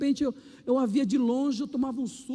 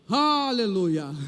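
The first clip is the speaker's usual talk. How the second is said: drawn-out hallelujah; 4 semitones lower, much slower than usual. Real, not acted.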